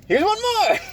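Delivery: very high, loud squeal; hammed-up falsetto offer; mock eager gift-giver. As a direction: pile on in a silly high voice. Joins in the gift-giving dad bit.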